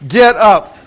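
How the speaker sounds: loud order; dad ordering collapsed son up, loud; command. Retold or imitated. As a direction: bark it at the boy.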